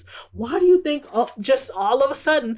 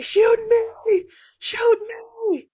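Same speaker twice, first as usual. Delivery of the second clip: mock cry, much higher, pleading; desperate plea; 12 semitones higher, much slower than usual. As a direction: cry out like a man grabbed by zombies. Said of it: "He's like".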